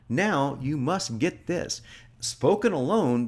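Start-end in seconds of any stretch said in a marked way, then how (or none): 0.1-1.8 "Now you must get this" drawn out slowly, "now" stressed; slow it right down and lean on "now"; deliberate, drawn-out command; slow emphatic read. Shown as a demonstration. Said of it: "Now, check that out".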